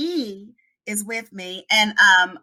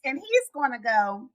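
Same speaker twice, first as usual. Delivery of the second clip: normal talking voice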